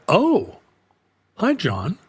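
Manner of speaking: high startled "Oh" then a small hi; surprised greeting. Retold or imitated. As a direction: pitch up in surprise, then drop. Receptionist surprised to see him.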